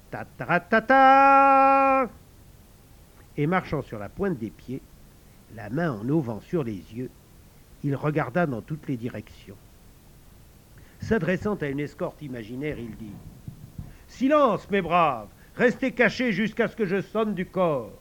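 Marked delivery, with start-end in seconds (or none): 0.1-2.1 loud high trumpet fanfare sung on ta; hammed-up bugle call; mock trumpet call. Tom blows his trumpet. 14.1-17.9 loud high order to hidden troops; rally your men boldly; child playing an outlaw chief; commanding captain. Commands imaginary escort.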